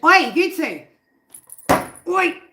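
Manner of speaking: loud, high-pitched telling-off; scolding shout. Real, not acted. Owner to two fighting cats, sharp scolding shout. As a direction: shout at the pets to break it up.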